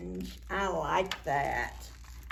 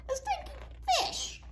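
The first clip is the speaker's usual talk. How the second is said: high squeal of mock disgust; squeaky disgust. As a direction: squeak it like it smells awful. Playful disgust to children.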